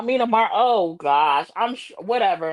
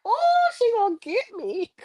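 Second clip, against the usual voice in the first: mock scared voice; 11 semitones higher than usual. Retelling her voice messages.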